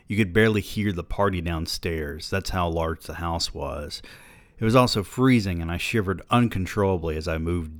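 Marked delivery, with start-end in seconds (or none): none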